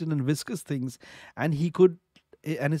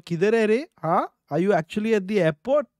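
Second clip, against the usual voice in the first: teasing call-out; 6 semitones higher, 3 dB louder, much faster than usual. Pretend. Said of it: "उनको बोलो".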